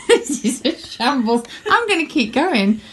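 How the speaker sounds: laughing through words. Real, not acted. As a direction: try to talk while cracking up. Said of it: "My sister's laughing".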